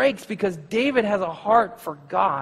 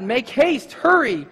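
higher, urgent call; cried out; 6 semitones higher, 5 dB louder, slower than usual. A narrator's reading. Jonathan urges the lad on, urgent call. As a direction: hurry the boy along with a shout. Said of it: "Jonathan cried out after the lad".

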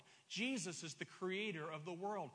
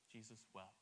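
much softer, low reverent hush; quiet, solemn reading of the verse; 8 semitones lower, 14 dB quieter than usual. Shown as a demonstration.

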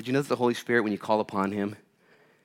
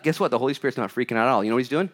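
ordinary talk

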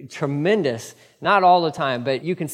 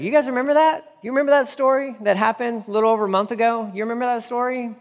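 much higher, faster, prodding the crowd; animated Peter; 7 semitones higher, faster than usual. Acted. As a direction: needle them, quick and bright. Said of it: "Peter's like".

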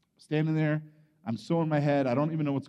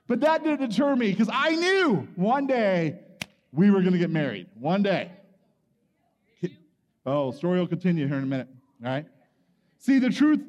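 usual speaking voice

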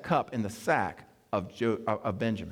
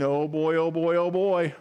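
a touch of jittery dread, slightly higher and louder; mock nervous panic; 4 semitones higher, 4 dB louder than usual. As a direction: play the brothers quaking in their sandals. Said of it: "their knees are really knocking now".

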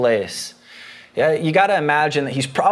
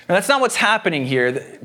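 ordinary delivery

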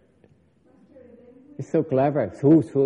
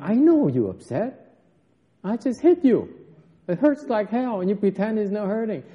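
higher-pitched knowing master, lightly acted; lightly voiced master; 6 semitones higher than usual. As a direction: gently call out the pretence, pitch raised. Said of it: "He said".

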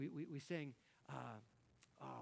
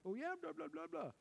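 much higher, silly blabbering voice; mock texting voice; 13 semitones higher than usual. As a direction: rattle it off like a careless texter. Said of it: "You ever do that?".

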